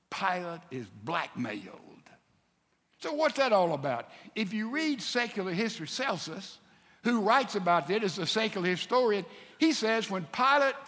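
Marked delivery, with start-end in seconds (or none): none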